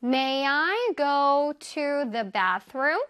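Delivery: May I go to the bathroom? 을 다시 아주 천천히; slow model sentence. Shown as a demonstration. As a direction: repeat slowly for learners to copy. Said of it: "One more time". (다시 한 번 천천히 또박또박)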